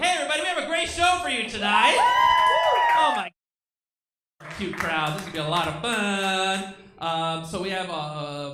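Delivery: loud, high host hype; emcee announcement. Acted. Host welcoming the crowd and introducing Melissa, loud hype. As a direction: pump up the crowd like a club host.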